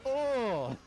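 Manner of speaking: sudden loud high yelp of shock; real startled outburst; surprised shout. Real, not acted. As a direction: blurt it as the hit lands. Sudden hit in boss fight.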